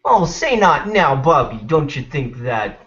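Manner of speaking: put-on slow, high northern American accent; sing-song northern mom accent, stretched out; Bobby's mom. Cartoon mother chiding her son, exaggerated northern American accent. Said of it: "Northern America, like".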